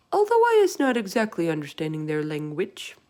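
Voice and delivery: big gentle giant voice, loud; BFG voice. A narrator's reading. Narrator voices the giant to Sophie. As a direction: voice the giant with wonder. BFG voice in read-aloud story.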